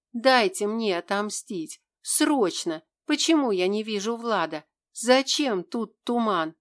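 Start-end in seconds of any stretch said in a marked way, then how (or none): none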